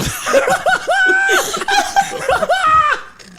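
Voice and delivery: high shrieking laugh; screaming laughter. Real, not acted. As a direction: lose it in laughter. Breaks down laughing.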